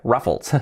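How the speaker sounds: ruffles as "RAF-fols"; English ruffles, not Spanish "RU-fles"; English pronunciation. Shown as a demonstration. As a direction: say ruffles with an "uh" first vowel. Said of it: "se dice ruffles".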